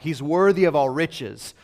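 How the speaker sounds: loud, rhythmic acclamation; ringing shout of praise; crying out. Acted. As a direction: proclaim it like a crowd praising its king. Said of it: "And so they all cry out".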